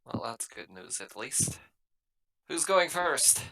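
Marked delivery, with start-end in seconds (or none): none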